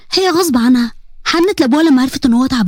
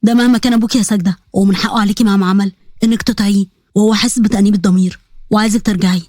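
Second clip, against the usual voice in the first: low voice, gently pleading; lower earnest uncle; 6 semitones lower than usual. Acted. Uncle asking his niece a favour, lower and earnest. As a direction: ask a favour like a loving uncle.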